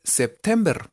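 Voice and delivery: September read slowly as written, Spanish-style; literal reading. Shown as a demonstration. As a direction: sound out September letter for letter. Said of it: "Así, literal". (September literal, como se escribe)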